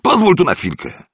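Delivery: high, loud summons; calling out. A narrator's reading. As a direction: call him over from a distance. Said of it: "zawołał Maliszewski". (głośne przywołanie wysokim głosem)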